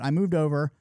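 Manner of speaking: usual speaking voice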